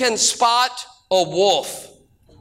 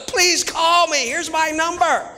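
mock lonely-hearts ad; 8 semitones higher than usual. Mocking a lonely dating ad.

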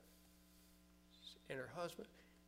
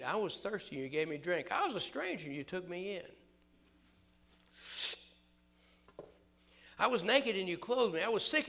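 loud preaching, rising higher and louder; preaching at full force; 4 semitones higher, 10 dB louder than usual. Acted. Preacher crying out Jesus' words to the church, climbing. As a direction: build the verses to a ringing climax.

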